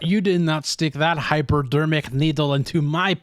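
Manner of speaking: hammed-up French accent. Officer regrets the needle business in French accent. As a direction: lament it in a mock French accent. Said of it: "we do the French accent".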